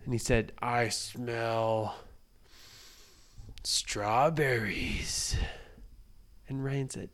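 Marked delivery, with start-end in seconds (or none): 0.6-5.4 giant voice. Acted. Giant sniffing.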